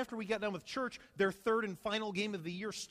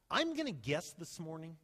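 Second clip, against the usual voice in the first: ordinary delivery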